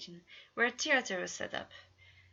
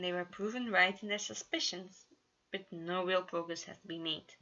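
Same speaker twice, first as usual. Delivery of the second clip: usual speaking voice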